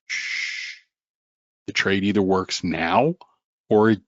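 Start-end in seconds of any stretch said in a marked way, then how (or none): 0.1-0.8 short soft shush. Acted. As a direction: hush the crazy voice. Shushing the crazy inner voice.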